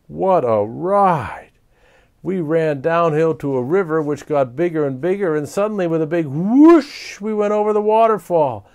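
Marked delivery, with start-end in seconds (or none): none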